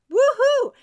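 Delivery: high, loud cheer; sudden high burst of triumph; celebratory whoop. Real, not acted. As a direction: whoop it up for the class. Celebrates the finished first row.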